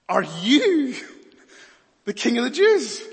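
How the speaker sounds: high, loud incredulous Pilate; Pilate's challenge. Acted. Preacher voicing Pilate to Jesus, loud and incredulous. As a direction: demand it like a scornful governor.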